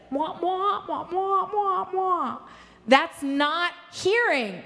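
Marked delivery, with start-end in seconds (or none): 0.1-2.4 higher wah-wah nonsense chatter; mock a partner's talk as noise; airy gushing darling tone.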